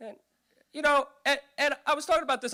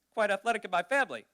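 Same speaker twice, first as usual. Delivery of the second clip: normal talking voice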